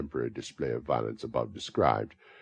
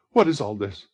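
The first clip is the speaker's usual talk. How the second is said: louder, alarmed question; startled cry; 6 semitones higher, 11 dB louder than usual. A narrator's reading. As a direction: blurt it out in alarm. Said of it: "cried the girl involuntarily".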